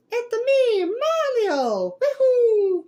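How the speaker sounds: very high, loud Italian cartoon hero; Mario's Italian voice. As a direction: whoop it out in an exaggerated Italian squeal. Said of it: "if you hear the Italian voice".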